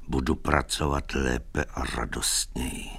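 low, soft, as if barely able to say it; bezesilná slova. A narrator's reading. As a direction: lips forming words with no strength left. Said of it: "Vyřknout je už sílu neměl". (zesláblým, tichým hlasem)

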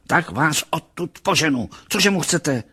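high-pitched, irritated outcry; exclaimed threat. A narrator's reading. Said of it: "vykřikla". (podrážděné vykřiknutí vysokým hlasem)

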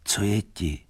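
usual speaking voice

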